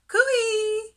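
high, loud, long sing-out call. A narrator's reading. Little fish hailing his friends, high loud call. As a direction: call out brightly across the water in a high voice. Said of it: "he called".

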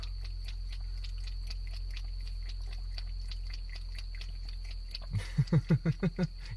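long, high helpless laughing fit; long real laugh. Real, not acted. Host laughing at Ohagi drinking the pot water, helpless laughter. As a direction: keep laughing until out of words.

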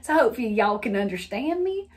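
folksy Southern y'all; Southern lilt, stretched "understand"; Southern accent. Shown as a demonstration. Hoping listeners understand her, Southern accent. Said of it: "I've never actually talked like that".

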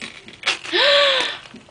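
sharp high gasp of wonder; amazed high gasp. Real, not acted. Unwrapping a friend's handmade gift, amazed high gasp.

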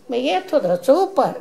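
usual speaking voice

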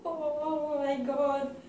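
slow shocked gasp, high; breathless from icy water; gasping from cold. Real, not acted. Ice water poured over self.

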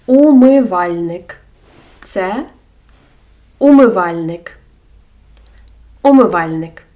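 умивальник with a rounded opening у; careful Ukrainian word. Shown as a demonstration. Teacher models умивальник for learners. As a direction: sound out умивальник clearly. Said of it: "Або у слові".

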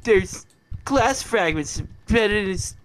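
high, slow, alarmed nurse voice; panicked nurse. Pretend. Gamer voicing a nurse warning the doctor, high and slow. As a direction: squeal it like a frantic nurse.

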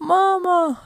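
gasp, then high loud cry of mama; startled cry. Acted. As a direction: caught by surprise. Kid startled to find mom. (شهقة ثم صرخة مفاجأة)

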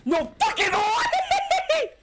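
high, slow, laughing yell; laughing shout. Real, not acted. Viewer hyped by the sword fight, laughing shout.

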